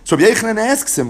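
usual speaking voice

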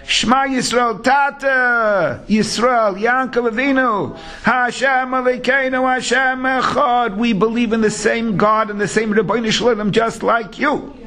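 fervent declaration. Retold or imitated. Sons fervently proclaiming their faith to their dying father.

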